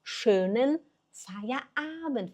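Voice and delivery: "Schönen Feierabend" very slow, each vowel drawn out; slow model of "Schönen Feierabend"; teaching pronunciation. Shown as a demonstration. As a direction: stretch "Schönen Feierabend" word by word for learners. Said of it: "Fala, schö, é o ö".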